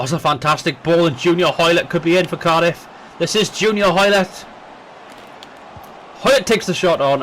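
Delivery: football commentator calling the match; live match commentary, building with the chances; play-by-play commentary. Acted.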